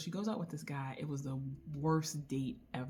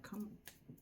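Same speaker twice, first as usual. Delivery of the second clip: whispered, flustered come on; breathy whisper; 6 semitones higher, 12 dB quieter than usual. Retold or imitated. Reacting to Grayson's line, a flustered whisper. I was like.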